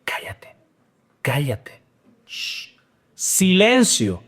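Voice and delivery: hushed, whispered cállate, shh; mock hushing crowd. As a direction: hush him like an angry crowd. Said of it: "una multitud que le dice". (multitud que lo manda callar en susurros)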